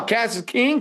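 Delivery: normal talking voice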